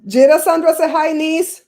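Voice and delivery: loud, high call across the room to the niece. Real, not acted. Calling loudly to the niece to say hi. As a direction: sing out her name so she hears.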